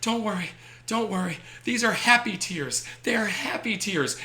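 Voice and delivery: high-pitched imitation of a reassuring child; tearful happy kid. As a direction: mimic a little boy insisting through happy tears. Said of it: "tears start flowing down his face".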